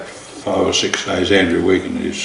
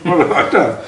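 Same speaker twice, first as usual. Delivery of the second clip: retold panic, higher and louder; panicked thought; 11 semitones higher, 4 dB louder than usual. Teller recalling his panic as the backer tears up the cheque, alarmed. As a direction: relive the dread of having blundered.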